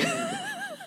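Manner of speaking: very high, soft yowl imitating the cat; imitated cat scream. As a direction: squeeze out a tiny high cat wail. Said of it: "but I hear her screaming".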